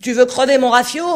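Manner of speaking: cri menaçant, très fort et aigu; menace hurlée. A narrator's reading. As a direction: menace l'iceberg à pleine voix. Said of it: "Olsen le menaçait du poing". (le capitaine menace l'iceberg en hurlant)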